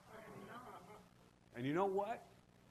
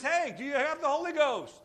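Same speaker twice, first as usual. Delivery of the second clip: excited question; 10 semitones higher, 8 dB louder than usual. Retold or imitated. Paul quoted asking disciples.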